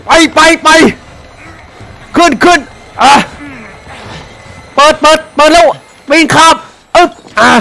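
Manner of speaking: high, loud panicked yelling; panicked shouting. Real, not acted. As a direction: yell in real panic while fleeing. Monster chasing, running.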